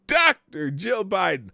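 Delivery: DOCTOR drawn out loud and high, then the name; mocking stress on Doctor. Shown as a demonstration. Host ribbing the title in a headline, mocking stress on Doctor. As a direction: punch Doctor with slow mock grandeur.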